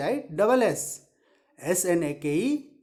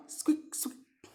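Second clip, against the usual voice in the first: tiny squeaks fading to almost nothing; teacher imitating a little mouse; 9 dB quieter than usual. Shown as a demonstration. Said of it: "mouse का sound".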